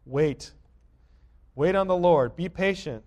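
loud, high ringing command; preaching at full force. Acted. Pastor repeating David's command to wait, loud preaching. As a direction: preach the word wait like a summons.

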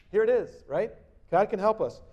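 ordinary talk, nothing marked

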